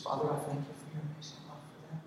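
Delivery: soft, quick little prayer; a brief, soft prayer over a stranger; short quiet prayer. Shown as a demonstration. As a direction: pray it briefly and softly.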